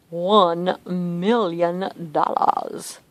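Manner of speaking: slow, deliberate Dr. Evil voice; "one million dollars" drawn out like Dr. Evil. Retold or imitated. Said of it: "like Mike Myers".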